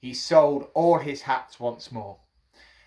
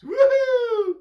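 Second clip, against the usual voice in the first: very high, loud gleeful hoot; monkey whoop; 7 dB louder than usual. A narrator's reading. Monkeys crowing at the hatter, gleeful whoop. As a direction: let out a squealing monkey whoop.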